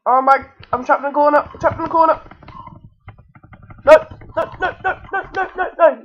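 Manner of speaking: loud, high panicked repetition; rising alarm, rapid-fire shouts. Real, not acted. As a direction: cry out as if cornered, voice climbing. Trapped and about to die.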